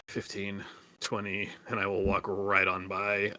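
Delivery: ordinary talk, nothing marked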